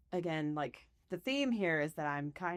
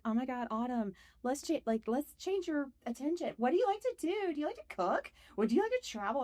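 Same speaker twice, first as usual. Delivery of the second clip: a higher, sing-song mock of a soothing operator; lightly voiced calming dispatcher, pitched up; imitating the emergency operator; 9 semitones higher than usual. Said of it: "this person's like".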